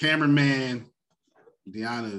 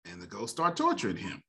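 ordinary talk

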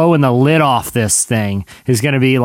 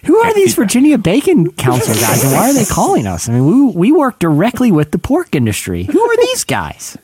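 high-pitched, hammed-up outrage; indignant callers; 6 semitones higher than usual. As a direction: demand answers like an affronted industry bigwig. Acts out angry industry phone callers.

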